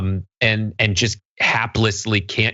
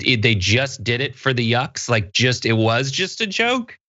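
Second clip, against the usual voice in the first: usual speaking voice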